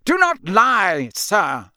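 high, loud, slow denunciation; angry shout. A narrator's reading. Crouch pointing his wand at Ron, shouting. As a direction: thunder at a liar. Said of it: "shouted Mister Crouch".

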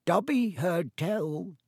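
high, slow elf voice, a touch hoarse. Acted. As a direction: draw it out like an awed little elf. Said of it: "he said hoarsely".

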